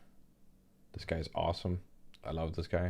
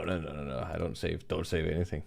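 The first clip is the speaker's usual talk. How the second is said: normal talking voice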